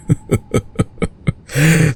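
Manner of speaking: high, delighted predatory laugh; amused vampire chuckle. Acted. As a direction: laugh at your prey's sweet weakness. Vampire mocks the hunter.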